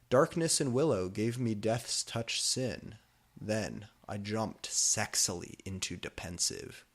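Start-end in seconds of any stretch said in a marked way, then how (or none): none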